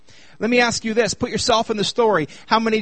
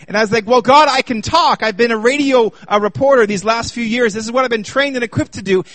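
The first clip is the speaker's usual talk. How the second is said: louder, higher preaching voice; full-force preaching; 4 semitones higher, 7 dB louder than usual. Acted. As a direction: drive the point home to the congregation.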